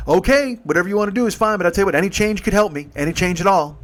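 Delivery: eager hustler angling for change; pushy helper. Retold or imitated. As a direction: sweet-talk him fast toward cash. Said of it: "And he's just like".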